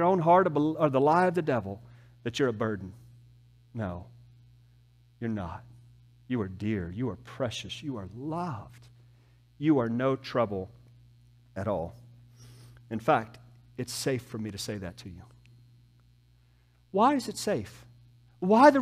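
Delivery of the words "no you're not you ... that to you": soft, low, tender comforting; tender reassurance. Real, not acted. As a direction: lower and soften to reassure, with unhurried pauses. Preacher reassures listeners they are loved.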